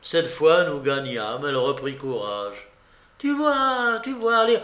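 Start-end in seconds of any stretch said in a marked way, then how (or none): 3.2-4.6 put-on high-pitched eager old lady; excited babouchka. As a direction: urge them on, high and eager. Babouchka excited after a win. (vieille joueuse enthousiaste, voix aiguë)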